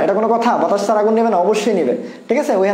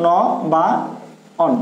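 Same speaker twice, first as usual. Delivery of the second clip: ন, বা, অন piece by piece with pauses; spelling it out; 3 semitones lower than usual. Shown as a demonstration. Teacher giving students the correct spelling, ন, বা, অন broken up by pauses. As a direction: dictate ন … বা … অন, each part separate. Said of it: "সঠিক বানান হলো".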